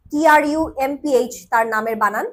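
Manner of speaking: normal talking voice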